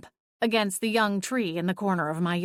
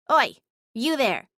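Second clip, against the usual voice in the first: sharp bark of a summons; barking call; 4 semitones higher, 3 dB louder than usual. A narrator's reading. Cat barking at the stranger on the lawn, loud summons. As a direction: call out to the figure like a guard. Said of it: "she barked".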